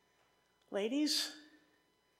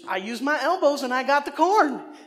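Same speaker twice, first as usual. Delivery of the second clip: loud, high-pitched mock boast; imitating triumphant mom; 4 semitones higher, 10 dB louder than usual. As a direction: play a mom crowing over her victory, much louder. Mom boasting of her corn victory.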